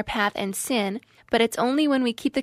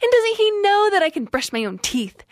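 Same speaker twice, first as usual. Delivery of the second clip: higher and louder, rising indignation; indignant retold thoughts, higher and louder; angry inner rant; 7 semitones higher, 4 dB louder than usual. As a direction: rant at him in your head, fuming. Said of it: "And I'm like".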